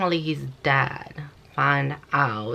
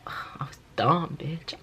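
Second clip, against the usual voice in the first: breathy whisper of self-reproach; a sighed, whispered admission; whispered aside; 5 dB quieter, slower than usual. Real, not acted. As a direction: whisper it to yourself, sighing.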